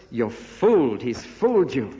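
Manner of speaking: imitating scornful Pharisees, hard stress on fooled; acted scorn, leaning on the key word; mock sarcasm. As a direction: mildly jeer it as a retold taunt. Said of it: "by their sarcasm".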